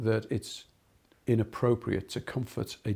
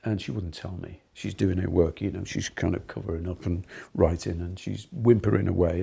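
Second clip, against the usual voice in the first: ordinary talk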